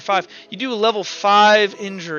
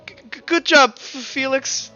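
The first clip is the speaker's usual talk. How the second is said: halting, stammered praise in a higher voice; Kilroy; 8 semitones higher, 4 dB louder than usual. Acted. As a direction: stutter out the congratulations. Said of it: "he goes".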